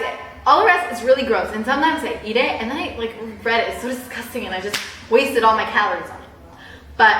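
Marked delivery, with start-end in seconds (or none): none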